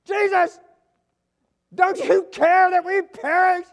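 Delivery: slow, shrieking plea for help; panicked disciples. Retold or imitated. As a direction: yell it like men sinking in a storm. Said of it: "They run up, they go".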